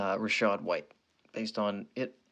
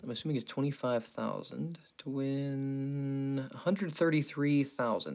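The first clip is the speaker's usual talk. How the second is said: normal talking voice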